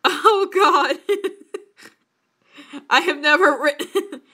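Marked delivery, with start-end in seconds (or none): 0.1-2.9 words broken up by giggles; real cracking up; laughing. Real, not acted. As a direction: try to talk through helpless laughter. Laughing at own writing.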